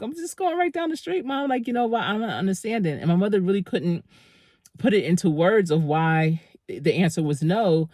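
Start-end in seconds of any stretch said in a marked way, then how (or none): 0.0-3.0 put-on whiny kid. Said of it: "And I'm like".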